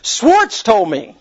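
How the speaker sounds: mock panicky. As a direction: blurt the blame like a cornered kid, hammed up. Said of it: "he's panicky. He doesn't know what to say".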